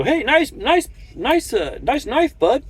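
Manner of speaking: high, sing-song mock of a stranger; sneering high parody of a nosy trucker; mocking nosy guy. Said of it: "They go".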